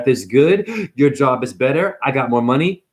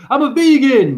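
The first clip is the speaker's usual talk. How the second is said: high, loud put-on proclamation; hammed-up high-pitched vegan boast; mock vegan; 12 semitones higher, 6 dB louder than usual. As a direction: parody a vegan proudly announcing it. Mocks self-styled vegan with a job.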